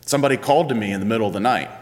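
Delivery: put-on fast, breathless boy telling of a voice; mild imitation of young Samuel, hurried; rushed Samuel. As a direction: rush it out like a startled kid. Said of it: "being like".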